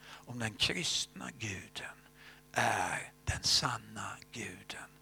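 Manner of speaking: slow, breathy whisper of a secret prayer; slow hushed prayer whisper. Retold or imitated. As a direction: hush it like a plea to God.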